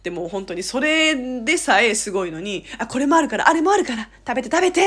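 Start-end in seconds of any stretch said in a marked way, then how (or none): 2.8-4.9 higher-pitched, insistent hospitality; eager urging. Retold or imitated. Korean host to guests, eagerly urging. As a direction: press more food on the guest eagerly.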